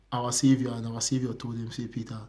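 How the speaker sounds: solemn, low lead-in to a quote; hushed retelling. Retold or imitated. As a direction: let the voice sink as you quote the Savior. Retelling Jesus correcting Peter.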